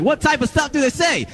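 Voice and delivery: high-pitched mock interviewer voice; prying interviewer. Imitates interviewer baiting her for gossip.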